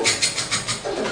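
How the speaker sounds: breathy unvoiced "탁탁탁탁탁탁" patter of feet; whispered footstep sounds. Telling how she hurried to church, whispered footsteps. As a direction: patter the steps out under your breath.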